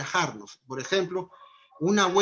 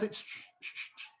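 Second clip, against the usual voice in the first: soft whispered shh; quiet unvoiced shush; gentle shushing; 11 dB quieter than usual. Shown as a demonstration. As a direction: hush the child with a gesture. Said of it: "entienden más por gestos".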